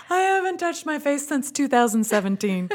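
high, tickled delivery on the edge of laughter; amused retold punchline. Wife retelling her paralyzed husband's joke, amused and high.

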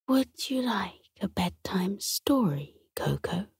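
gentle grandmother's whisper; old woman whispering. A narrator's reading. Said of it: "His grandma whispered".

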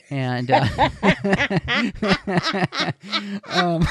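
laughing at her own joke; breaking up with laughter; giggling. Real, not acted. Host laughing at own pun.

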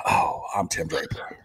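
whispered in stunned awe; awed whisper. Pretend. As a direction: breathe it out, amazed. Imagines seeing his portfolio.

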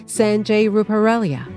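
ordinary delivery

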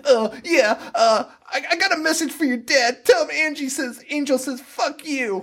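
high, laughing mock Lori voice; hammed-up hysterical Lori, pitched high; toned-down screaming Lori. Mocking the actress, high laughing Lori voice. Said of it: "I'm doing it at, like, a toned down version".

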